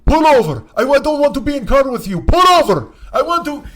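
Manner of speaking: put-on drunk passenger screaming to pull over; enraged accented yell. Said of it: "he goes".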